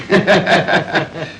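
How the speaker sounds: hearty chuckling at his own remark; easy, amused laughter. Acted. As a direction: laugh along, pleased with the joke.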